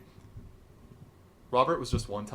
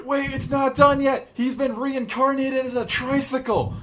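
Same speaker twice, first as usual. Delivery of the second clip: high, loud acted holler; yelling back; 13 semitones higher, 7 dB louder than usual. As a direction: shout an urgent warning across the distance, hammed-up. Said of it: "yells back to the officer".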